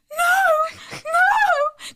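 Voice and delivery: high, loud crying scream of no; hysterical screaming. Retold or imitated. Mother screaming at the cut ponytail, high, loud and crying. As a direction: scream it like someone got run over. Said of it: "I'm screaming".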